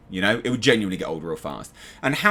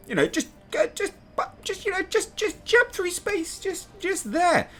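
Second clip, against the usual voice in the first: high strained jump voice; 3 dB quieter than usual. Acted. Acting out Naomi's quick jump through space, high and strained. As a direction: squeak it out haltingly, far above your own pitch.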